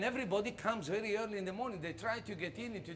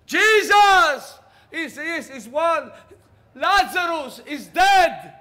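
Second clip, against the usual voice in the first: very high, very loud, slow alarm; frantic shout; 10 semitones higher, 18 dB louder, much slower than usual. Acted. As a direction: burst in yelling the bad news. Frantic messenger bursts in.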